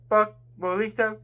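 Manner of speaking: usual speaking voice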